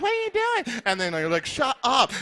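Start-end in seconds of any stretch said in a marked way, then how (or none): 0.0-0.8 put-on very high falsetto girl; mock girl's voice. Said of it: "this girl comes over and she's like". 1.5-2.1 high-pitched, snappy mock retort; mock quarrel. Acted quarrel with the girl.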